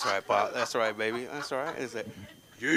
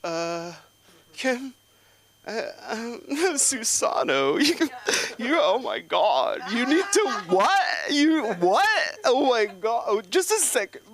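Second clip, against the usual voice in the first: high, loud flustered stammer; secretary flustered by a patient; flustered receptionist; 13 semitones higher, 5 dB louder, slower than usual. Retold or imitated. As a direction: lose composure on the phone.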